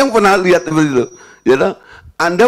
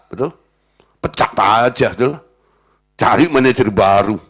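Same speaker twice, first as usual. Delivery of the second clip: ordinary delivery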